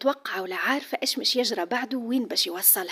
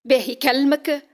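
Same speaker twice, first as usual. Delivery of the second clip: stern question; 4 semitones higher, 7 dB louder, slower than usual. Acted. Father interrogates daughter.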